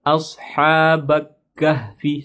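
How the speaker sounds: "Ashabak kahfi" with the k doubled, the mistake; wrong doubled k. Shown as a demonstration. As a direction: show the error "Ashabak kahfi". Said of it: "salah ya". ("Ashabak kahfi" dibaca salah dengan k ganda)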